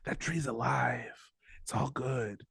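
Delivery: very soft, slow soothing voice; hushed, low-key mentor calm; reassuring. Acted. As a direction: talk someone down gently, unhurried. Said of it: "so reassuring".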